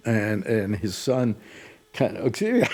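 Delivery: ordinary talk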